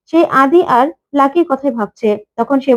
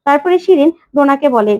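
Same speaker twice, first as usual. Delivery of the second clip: usual speaking voice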